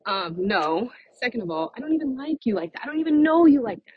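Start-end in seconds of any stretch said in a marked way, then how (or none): 1.8-3.9 sassy rebuttal. Real, not acted. Sassy rebuttal to clingy guy.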